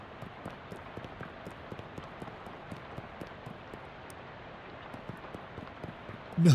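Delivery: long silent wheezing laugh; laughing helplessly without voice; breathless laugh. Real, not acted.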